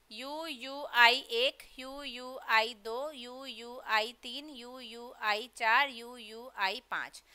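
slow, low, soft rhythmic count. Shown as a demonstration. Teacher counting out five anapest feet for students, slow counting.

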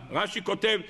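ordinary delivery

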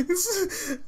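high words breaking into laughter; crying with laughter. Real, not acted. Laugh-crying at the screen. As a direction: try to speak through laugh-tears. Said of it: "I'm actually crying a little bit".

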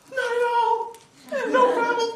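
very high, loud, strained politeness; panicked cover-up. Acted. Guy hiding a roach from his date, high panicked assurance. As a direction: squeak out reassurance under panic.

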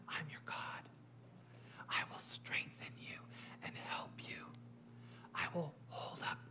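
soft, intimate whisper; whispering as God. Acted. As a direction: speak God's comfort in a bare whisper. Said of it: "God whispers".

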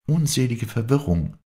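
usual speaking voice

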